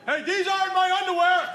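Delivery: put-on high-pitched teenage outrage; son's high, indignant holler; outraged yell. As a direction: call out in protest from another room. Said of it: "I just yelled back".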